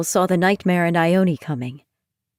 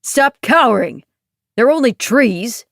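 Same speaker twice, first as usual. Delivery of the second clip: booming shout; 4 semitones higher, 4 dB louder than usual. Acted. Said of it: "a booming voice called".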